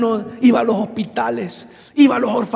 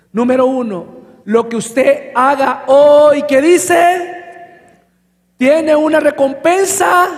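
loud, slow, driving the point home; preaching at full force; 4 semitones higher, 6 dB louder, slower than usual. Real, not acted. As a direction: proclaim it to the congregation, slow and loud.

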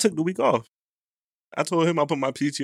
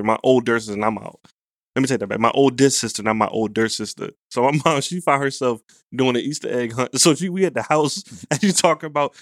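ordinary talk

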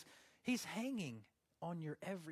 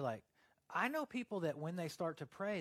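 ordinary talk, nothing marked

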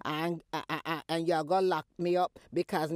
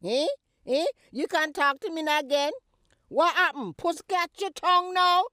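taunting; 8 semitones higher, 7 dB louder than usual. Acted. Mother taunting silent daughter.

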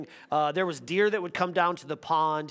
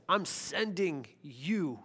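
soft, slow, pleading prayer voice; Jesus asking the Father, voiced quietly and tenderly; gentle prayer; 2 semitones lower, 5 dB quieter, slower than usual. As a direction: pray it softly, almost under the breath. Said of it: "And he says".

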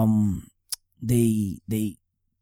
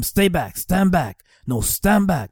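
higher, firmer mock command at onlookers; retold police yelling; 5 semitones higher, slower than usual. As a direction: bark a mild mock order to stand back. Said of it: "They're yelling at them".

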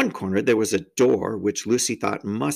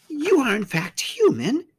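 high-pitched faun character voice; high, wondering question from a faun; amazed faun. A narrator's reading. Faun asking if the girl is human, high and wondering.

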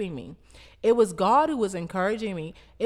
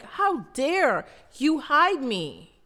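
slow, higher mock-indignant child; 7 semitones higher, 3 dB louder, slower than usual. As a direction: act out a childish outrage, drawn out. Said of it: "I'm like".

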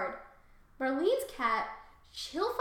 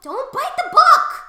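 very loud, high scolding shout; real alarmed yell; 19 dB louder, slower than usual. Real, not acted. Owner stopping pet biting book, high alarmed shout. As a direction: yell sharply to stop the pet.

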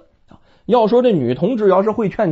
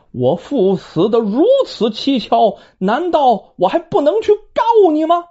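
angry retort; 6 semitones higher than usual. Acted. Son retorts to angry stepmother.

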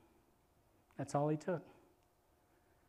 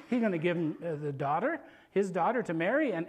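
ordinary talk

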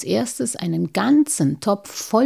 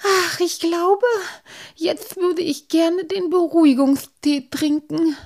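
high, squeaky weary plea; squeaky character in need of calming; sighing, unnerved; 10 semitones higher, 5 dB louder than usual. A narrator's reading. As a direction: sigh it out in a high, rattled voice. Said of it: "murmelte Halbschuh".

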